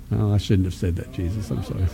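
sheepish aside. Real, not acted. Quick apologetic aside to Jesus.

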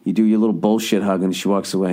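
ordinary talk, nothing marked

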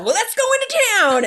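high, loud, sing-song pioneer voice; pioneer girl. Retold or imitated. Girlfriend in a bonnet and apron announcing the town trip, high and sing-song. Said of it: "I came out and I'm all".

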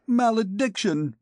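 high, sharp outcry; cry as he falls. Acted. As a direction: cry out in despair while falling. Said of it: "he cried as he fell".

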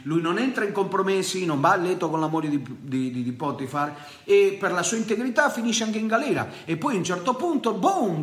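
high, fervent preacher's voice; preaching at full force. Acted. Preacher telling the congregation Joseph's fall, fervently. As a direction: preach it to the church with fire.

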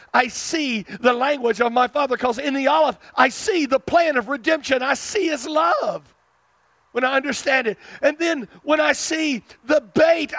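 high, driving sermon delivery; impassioned preaching. Real, not acted. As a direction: preach it with rising fervour, punching key words. Preaches on the Hebrew letters.

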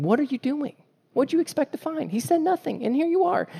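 high, incredulous what-are-you-doing; baffled scolding, thrown way up high; mock exasperation. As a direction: pitch it up in disbelief. Exasperated God addressing gatherers.

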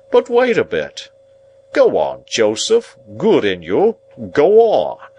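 high, loud, pompous urging; booming self-important encouragement; patronizing Pumblechook. Acted. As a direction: egg him on grandly. Narrator voices Pumblechook urging Joe.

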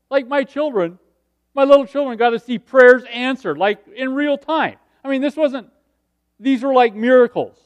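loud, high, fired-up testimony; impassioned preaching. Real, not acted. Preacher telling the congregation about answered prayers, fired up and loud. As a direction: preach it loud, pitched well up.

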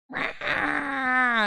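high, drawn-out imitation wail; mock baby cry. Mum imitating her son crying at twelve thirty, mock wail. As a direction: imitate a baby's raspy wail. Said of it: "his cries of terror".

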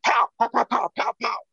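high, popping mimicked gunfire; imitated gunshots. Survivor mimicking a gun going off above him, imitated gunshots. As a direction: fire off sharp, high-pitched "pow" bursts. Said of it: "then I hear".

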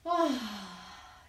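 long sighing breath out; audible exhale. Shown as a demonstration. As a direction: let the air go with an ah. Breathing exercise, exhale sound. (exhalación con suspiro)

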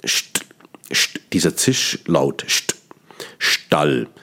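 "st" hissed sharply, then "Stall" with a cold hard st; hissing st. Shown as a demonstration. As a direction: isolate the hiss of "st". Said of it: "jetzt hören Sie schon".

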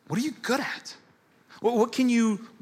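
high, pointed question to the congregation. Acted. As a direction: lift it up high like a challenge. Preacher's repeated key question.

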